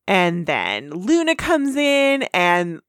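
ordinary talk

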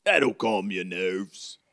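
slow, reassuring character voice; drawn-out storybook helper voice; Hagrid. Acted. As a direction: reassure slowly in a put-on accent. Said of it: "And then he goes".

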